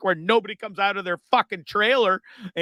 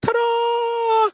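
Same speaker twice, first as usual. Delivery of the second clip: very high, ringing ta-da; showy reveal; 4 dB louder than usual. Acted. Host showing the plant in full glory, showy ta-da. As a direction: unveil it with a sustained high flourish.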